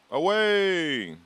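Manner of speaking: loud, high drawn-out cry; calling out. Pretend. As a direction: belt it out high and long. Calling out during the game.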